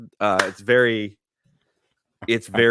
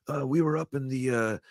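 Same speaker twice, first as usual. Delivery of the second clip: Seagal's put-on accent; 3 semitones higher than usual. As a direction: drag out Himalaya in a mocking Seagal accent. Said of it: "he's like".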